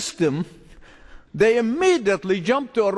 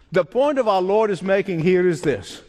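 usual speaking voice

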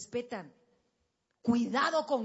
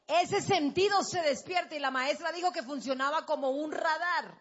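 ordinary talk, nothing marked

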